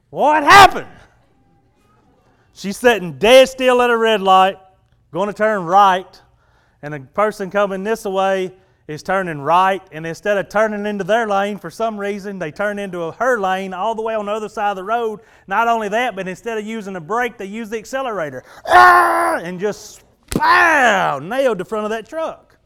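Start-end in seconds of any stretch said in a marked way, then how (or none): 0.1-0.9 loud, high preacher's question; booming mock-incredulous ask; dramatic question. Dramatic rhetorical question to congregation. 18.7-19.4 loud high yelp of impact; sudden shouted cry of alarm; mock crash scream. Acting out the crash.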